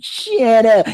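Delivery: hammed-up actor snapping at the crew; mock snap. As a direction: play the offended star.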